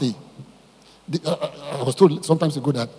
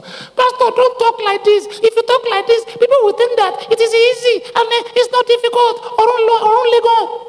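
very high, squeaky mimicked complaint; hammed-up high-pitched protest of worried people; mock objectors; 4 dB louder than usual. People warning the pastor not to make it easy, mimicked shrilly. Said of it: "People are saying".

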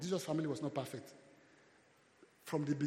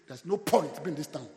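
loud preaching burst; preaching shout; 2 semitones higher, 12 dB louder than usual. Real, not acted. Preacher urging the congregation, shouted. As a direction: drive it home at full volume.

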